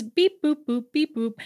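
high, playful robot beeps; sing-song mimicked beeps and boops; little robot noises. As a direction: imitate a child's robot beeping. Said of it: "making little robot noises".

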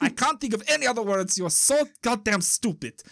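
exasperated comic Russian voice; Russian accent. Acted. Russian criminal berating the newcomer, exasperated Russian accent. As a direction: scold in a put-on Russian accent. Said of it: "the Russian guy goes".

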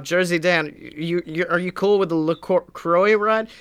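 ordinary talk, nothing marked

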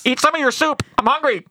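high, comic pleading pilot; hammed-up distress call in a silly accent; Bulldog. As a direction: beg in a goofy high voice. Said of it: "picturing that scene with that voice".

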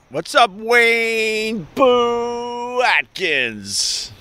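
high, long-held name call; drawn-out high hail to a buddy; hammed-up greeting.

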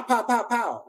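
mock gunshot pops, pitched high; imitated gunshots. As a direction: pop each "pow" like a shot going off.

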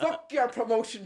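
mock outburst through laughter; laughing quote. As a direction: blurt it out while laughing. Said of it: "she says".

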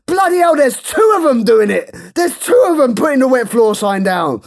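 high, loud shouted excitement; hyper exclamation. Retold or imitated. Worker shouting as two staff bring the sign, high and loud. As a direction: bellow it in frantic disbelief. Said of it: "He goes".

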